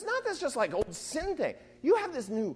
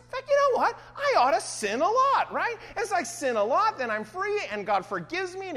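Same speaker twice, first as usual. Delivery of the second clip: eager naive believer; 7 semitones higher than usual. Acted. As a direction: sell the bad idea with bright glee. Believer reasons he should sin more.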